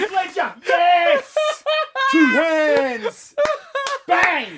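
high excited cheering; excited celebration. Real, not acted. Host celebrating his quiz win, excited. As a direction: crow the winning answer.

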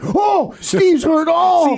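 mock worried cry. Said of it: "I was more like".

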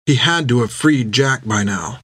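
usual speaking voice

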